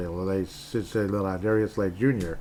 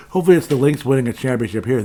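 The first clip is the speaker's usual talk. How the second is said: hyped; 3 semitones higher, 7 dB louder, faster than usual. Real, not acted. Hyped for Lynx.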